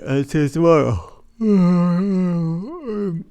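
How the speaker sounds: saying goodbye through a yawn. Real, not acted. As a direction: stretch the words out in a big yawn. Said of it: "I'm yawning".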